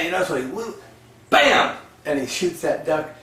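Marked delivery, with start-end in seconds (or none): 1.3-1.8 loud, high exclaimed bang; imitated gunshot. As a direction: fire off the word like a shot.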